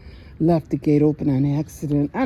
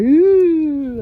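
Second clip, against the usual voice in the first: high, louder cartoon "ooh" in a put-on voice; voicing the dog; 10 semitones higher, 7 dB louder than usual. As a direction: play the guilty dog caught out. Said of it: "He just looked at me like".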